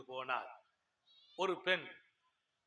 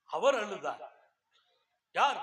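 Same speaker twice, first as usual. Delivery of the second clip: usual speaking voice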